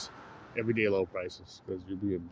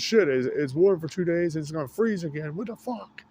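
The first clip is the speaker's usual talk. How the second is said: high, louder mock-furious bird voice; voicing an annoyed bird; 8 semitones higher, 6 dB louder than usual. Voicing a bird angry about the coming freeze, high and mock-furious. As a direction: squawk out the complaint in a pitched-up grumpy bird voice. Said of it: "he's pissed off about the storm coming".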